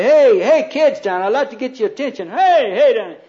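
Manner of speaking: high, loud call for attention; skit calling out. Acted. Doss in a skit hailing parents below, calling out. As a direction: holler down to the kids in imitation. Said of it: "Doss used to do this skit".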